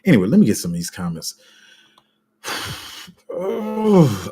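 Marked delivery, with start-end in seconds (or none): 3.3-4.3 high frustrated wail of annoyance; annoyed cry. Real, not acted. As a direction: let out an exasperated high cry. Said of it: "I'm so annoyed".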